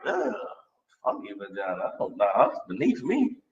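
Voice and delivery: quiet sneering disgust. Retold or imitated. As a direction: turn your nose up quietly. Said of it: "it's like".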